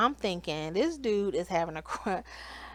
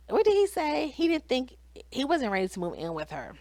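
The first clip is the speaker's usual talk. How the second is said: normal talking voice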